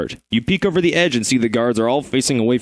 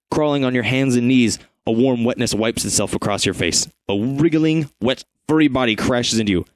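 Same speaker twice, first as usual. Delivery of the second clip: normal talking voice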